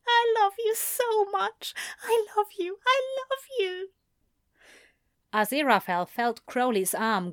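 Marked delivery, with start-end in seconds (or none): 0.1-3.9 high, tearful angel voice; crying angel. A narrator's reading. Crying angel declares love on Crowley's shoulder, high and tearful. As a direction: weep the words into his shoulder. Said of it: "crying openly on his shoulder".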